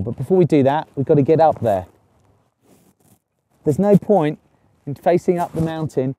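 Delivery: usual speaking voice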